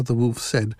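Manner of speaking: usual speaking voice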